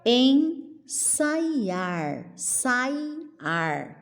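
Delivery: "Ensaiar" slowly as en, sai, ar; syllable by syllable. Shown as a demonstration. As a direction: hold each syllable, pause between. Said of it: "como que eu separo".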